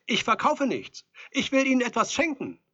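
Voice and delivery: loud, high, insistent pitch; calling through door. A narrator's reading. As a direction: call your offer through the door. Visitor calls through the door.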